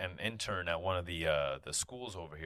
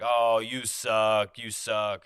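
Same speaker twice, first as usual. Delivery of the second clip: mock taunt; 2 semitones higher, 8 dB louder, much slower than usual. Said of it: "they're like".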